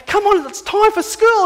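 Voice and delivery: put-on high, eager kid's wake-up call; a little kid dragging parents to school; excited child.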